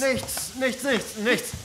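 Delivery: high, hurried cover-up; squeaky innocent nothing; nervous denial. Real, not acted. Hiding the mess from him. (Mutter verheimlicht das Chaos, hoch und nervös)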